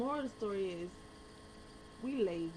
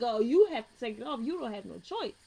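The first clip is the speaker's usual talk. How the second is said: louder, firm official voice; firm insistence; 2 semitones higher, 8 dB louder than usual. Retold or imitated. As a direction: lay down the rule. DMV lady insisting.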